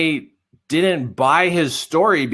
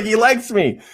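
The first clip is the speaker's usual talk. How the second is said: amazed relief; 6 semitones higher than usual. Retold or imitated. Amazed relief at being liked.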